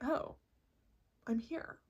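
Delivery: very soft, unexcited realization; small, hushed matter-of-fact discovery; normal, underwhelmed. Acted. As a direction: notice it quietly, no fanfare. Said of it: "It feels shockingly normal".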